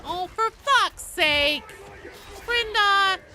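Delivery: loud, high burst of frustration; exasperated shout. Real, not acted. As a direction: cry out mid-game, fed up. Exasperated outburst during play.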